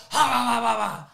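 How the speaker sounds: mock outburst. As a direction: imitate an over-energized coach blurting noise. Mimics misdirected high energy outburst.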